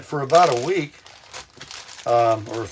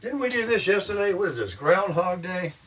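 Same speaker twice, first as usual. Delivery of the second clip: viewers' mock question; 6 semitones higher, 4 dB quieter than usual. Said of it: "Some of you are saying".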